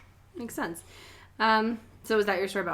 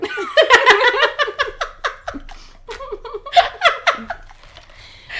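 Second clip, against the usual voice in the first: high, loud burst of laughter; helpless high cackling; laughing fit; 8 dB louder than usual. Real, not acted. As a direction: crack up hard. Reacting to the spilled Coke story.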